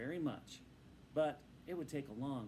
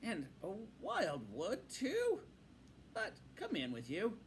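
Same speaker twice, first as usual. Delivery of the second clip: a slow, higher welcoming Badger; different Badger voice; 7 semitones higher than usual. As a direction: warm up the voice for guests. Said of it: "a quite different voice".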